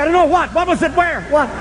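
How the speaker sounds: put-on panicked drunk dad. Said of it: "I said".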